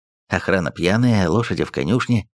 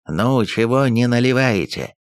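ordinary delivery

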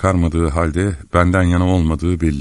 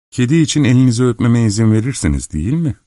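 quick, higher-pitched coaxing; grinning request; 6 semitones higher, faster than usual. A narrator's reading. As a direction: ask eagerly through a grin. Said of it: "Subay sırıtarak ona sokuldu". (sırıtarak, hevesle)

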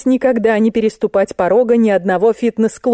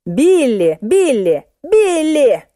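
exasperated calls, climbing high on the last; yelling; 8 semitones higher, much slower than usual. A narrator's reading. Narrator corrects deaf Musenka, yelling. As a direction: shout the name over and over, building. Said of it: "заорала я".

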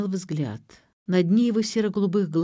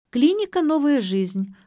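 high, chirping receptionist, loud at the start; perky high-pitched switchboard voice; chirpy; 9 semitones higher, 6 dB louder than usual. A narrator's reading. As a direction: chirp it out like a phone greeting. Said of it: "прочирикала девушка".

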